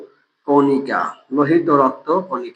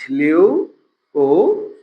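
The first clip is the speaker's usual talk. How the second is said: slow drawn-out term; much slower than usual. Shown as a demonstration. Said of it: "teacher drills term slowly".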